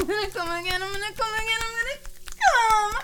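high, tearful repeated warning; breathless, building whimper; rising urgency. Acted. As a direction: climb in pitch toward a cry. Building to a climax in roleplay.

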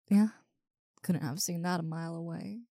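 normal talking voice

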